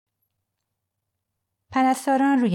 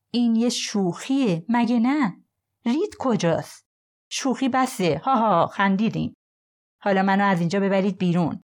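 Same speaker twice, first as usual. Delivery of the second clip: a lightly voiced disbelieving man, a bit higher; Nathan insisting; 3 semitones higher than usual. Handcuffed man tells agents the joke is over, nervous disbelief. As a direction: push back nervously, half laughing it off.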